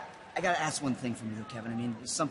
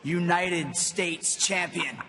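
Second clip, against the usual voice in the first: slow emphatic; 3 dB louder, much slower than usual. Real, not acted. Final title savored.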